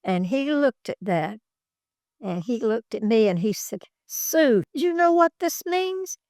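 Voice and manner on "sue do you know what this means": retold emotional question in a much higher pitch; tearfully emotional. Coach moved by the photo asks Sue, imitated high-pitched emotion. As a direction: imitate someone moved, pitch lifted high, without crying. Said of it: "And tears were coming out of his eyes".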